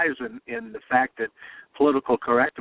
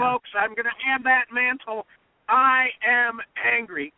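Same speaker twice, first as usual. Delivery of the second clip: slow, higher, every word of "I am angry" hammered; defiant angry quote; 8 semitones higher, slower than usual. Retold or imitated. Host quoting Trump's debate reply, slow and defiant. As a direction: plant your feet and claim the anger. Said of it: "And Trump said".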